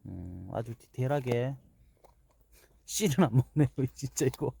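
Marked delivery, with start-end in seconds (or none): 2.9-4.6 laughing. Real, not acted. Laughing at dog leaving seeds.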